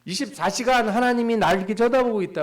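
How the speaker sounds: 높고 크게 과장된 신자 목소리; 부담에 질린 신자를 과장해서; burdened believer. Acted. (하나님이 지켜본다는 생각에 질린 신자를 크게 흉내 내며)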